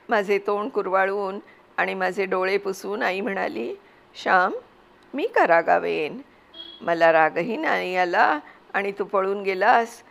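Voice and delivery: ordinary delivery, nothing marked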